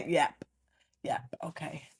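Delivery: low, robotic brush-off; monotone. Retold or imitated. Annoyed wife answering husband's questions, low monotone. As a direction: give nothing back, voice dropping away. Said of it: "I have to go monotone with you".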